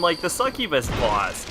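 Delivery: usual speaking voice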